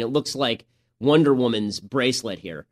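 ordinary talk

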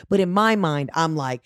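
normal talking voice

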